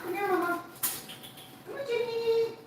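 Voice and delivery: very high pet-calling voice; high, held-note summons; calling a dog. Real, not acted. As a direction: call the dog over in a very high voice.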